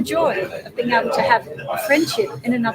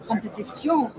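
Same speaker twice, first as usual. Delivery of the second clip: composition said the French way, soft and nasal; French accent; 5 dB quieter than usual. Shown as a demonstration. Teaching a husband French words, French accent. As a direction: say composition like a Parisian. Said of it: "with a French accent".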